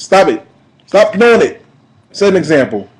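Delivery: scolding shout. Real, not acted. Vlogger yelling at reckless old heads to stop, loud scold. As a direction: tell them off hard. Scolding older adults who drive badly.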